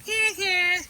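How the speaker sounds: high, loud mocking heh heh; taunting squirrel snicker. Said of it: "he taunts these animals".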